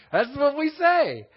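high, loud, drawn-out laughing delivery. Real, not acted. Teacher tickled by his own Texan translation, laughing.

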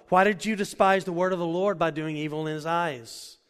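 loud, high preaching over music; loud preached rebuke. Acted. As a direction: press the question hard. God rebukes David, preached.

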